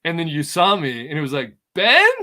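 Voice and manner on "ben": high, loud rising question; mock-amazed, voice leaping up; astonished recognition. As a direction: imitate someone stunned to spot an old friend. Imitates her recognizing old friend.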